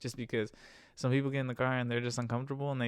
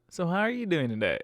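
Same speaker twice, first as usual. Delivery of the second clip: higher, louder chirpy question; mock repeat question; 5 semitones higher, 7 dB louder than usual. Driver mimicking passenger repeating the question, loud chirpy. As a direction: ham up the passenger asking again.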